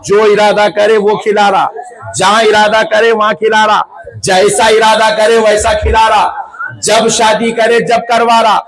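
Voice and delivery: insistent rhythmic list, pitch held up; rousing preaching. Real, not acted. Preacher telling the crowd how God keeps providing, rousing. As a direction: drive each phrase home in a steady, rising chant-like cadence.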